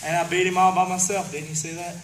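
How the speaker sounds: loud heel boast; wrestling promo. Acted. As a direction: brag it to the crowd. Champion boasts.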